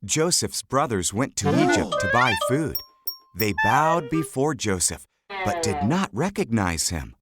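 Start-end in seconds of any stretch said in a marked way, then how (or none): none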